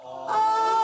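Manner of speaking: high, loud sustained ah; ah on the exhale. Shown as a demonstration. Coach demonstrates an exhaled ah sound for students. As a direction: ride the breath out on ah. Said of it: "we're gonna make an ah sound".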